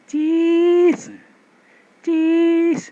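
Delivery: high, loud fake "cis"; a stiff posed "Cis, cis" for the camera; forced cheese. Shown as a demonstration. ("cis" tinggi dan keras, dipaksakan)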